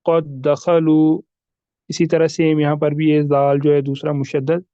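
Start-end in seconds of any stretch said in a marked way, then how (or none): 0.1-1.2 "क़द दखलू" slow, both द fused; careful recited "क़द दखलू" with a held double d; merged pronunciation. Shown as a demonstration. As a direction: stretch "क़द दखलू" as "qad-dakhalu". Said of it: "हम पढ़ेंगे".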